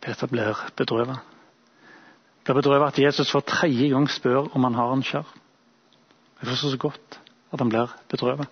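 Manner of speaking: ordinary delivery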